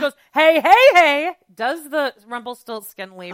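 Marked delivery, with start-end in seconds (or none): 0.3-1.3 loud, high mock party entrance; boisterous greeting. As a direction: burst in hollering to the guys. Acts Seth's loud entrance greeting.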